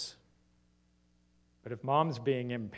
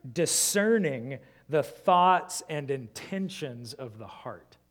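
scripture reading; 5 dB louder than usual. Acted.

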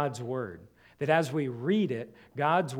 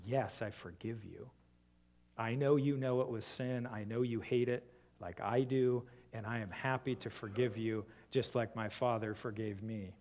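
tender forgiveness; 4 semitones lower, 7 dB quieter than usual. Pretend. Gentle forgiving reply.

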